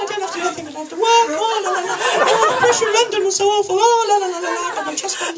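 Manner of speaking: very high, airy sing-song la-las; mock blissfully clueless expats in a high chirp; carefree expats. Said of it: "they're all wandering around going".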